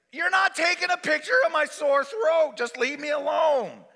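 high, loud shouting; acted exasperated outcry; outraged protest. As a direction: shout them away from you. Acted patient refusing loudly.

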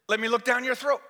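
high, loud demand; acted pushy request; bossy nurse. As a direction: insist on examining the patient. Acted wife demanding to look.

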